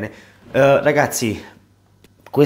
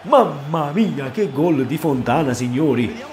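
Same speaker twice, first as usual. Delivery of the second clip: excited; 3 semitones higher, slower than usual. Real, not acted. After equalizing goal.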